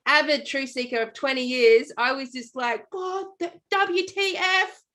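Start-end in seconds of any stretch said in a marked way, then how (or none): 2.9-4.8 high, slow, drawn-out cry at the sky; hammed-up retold yell at God; mock scream at God. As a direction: rail at God, stretching every word. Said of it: "I screamed my head off".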